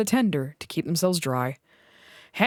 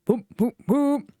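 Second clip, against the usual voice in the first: whistle whoops; 5 semitones higher than usual. A narrator's reading. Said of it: "he whistled".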